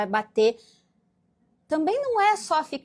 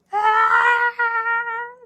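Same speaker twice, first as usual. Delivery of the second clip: put-on much higher, louder child's wail; 9 semitones higher, 6 dB louder than usual. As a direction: wail like a toddler whose toy is gone. Child cries when toy removed. (berro de criança, bem agudo e alto)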